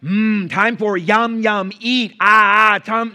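put-on loud, slow baby-talk for a foreigner; high, booming caveman-simple words; idiotic over-explaining. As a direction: mime it with your voice, loudly and slowly. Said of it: "you start looking like an idiot".

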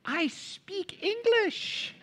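put-on high, drawn-out Romanian-accented English; mock broken-English Romanian accent, very slow. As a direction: imitate a Romanian speaking slow, careful English. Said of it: "his broken English accent".

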